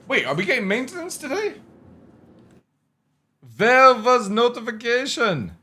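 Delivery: light put-on German accent; fake German accent. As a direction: toss off a mock German accent. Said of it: "I put it in a German accent".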